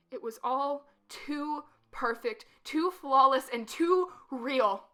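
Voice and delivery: loud, slow, emphatic; intense emphasis. Acted. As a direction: hammer each phrase slowly. Climactic realization.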